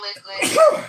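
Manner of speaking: high, loud yell of Liquid; mock shout. Friend teasing Liquid, yelling his name high. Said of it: "Say it again".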